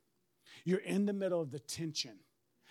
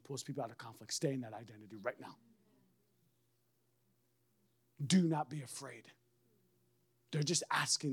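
lower, softer, gentle counsel; calm reassuring; 7 semitones lower, 6 dB quieter than usual. Retold or imitated. The Holy Spirit reassuring an anxious pastor, soft and calm. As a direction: settle him down softly. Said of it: "Holy Spirit says".

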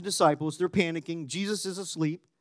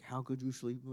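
much softer, disbelieving question in a changed voice; incredulous; 4 semitones lower, 13 dB quieter than usual. Retold or imitated. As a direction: scoff at someone sleeping through it all. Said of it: "you're like".

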